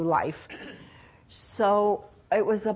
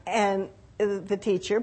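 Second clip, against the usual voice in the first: ordinary talk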